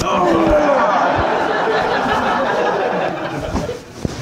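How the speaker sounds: high breathy giggle at own joke; real giggle; laughing. Real, not acted. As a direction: crack up at yourself.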